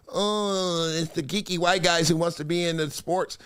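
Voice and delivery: soft, hesitant mock of the other guy; mocking imitation. Host mimics a player's excuse, soft and mocking. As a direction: mimic him mumbling an excuse, lightly. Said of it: "why would you pull".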